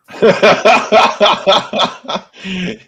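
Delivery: long, loud burst of rapid laughs; loud laughter. Real, not acted. Co-host cracking up at the bullpen joke, loud laughter.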